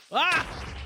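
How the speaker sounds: sudden high-pitched yelp; startled high yelp. Real, not acted. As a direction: yelp in surprise. Startled in game.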